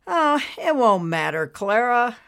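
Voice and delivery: lightly voiced husband shaking his head; resigned husband. Husband answers his wife.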